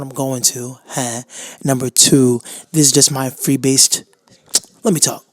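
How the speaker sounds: ordinary delivery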